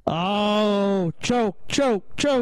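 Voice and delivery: real teasing jeer; mocking taunt. Real, not acted. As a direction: taunt a friend who choked. Mocking friend who refused.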